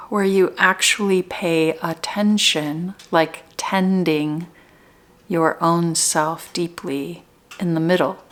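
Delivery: spacious, measured meditation leading; guided meditation. Real, not acted. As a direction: guide them gently into their center, unhurried. Leads guided meditation, slow with pauses.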